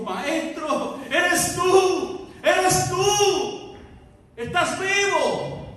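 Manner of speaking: high, loud overjoyed cry; joyful recognition. Acted. María recognizes risen Jesus. (María reconoce a Jesús, grito de alegría)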